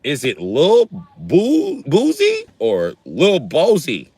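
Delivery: "Boozy" and "Bozy" drawn out slowly and high; mock mispronunciation. Shown as a demonstration. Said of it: "I pronounce his name".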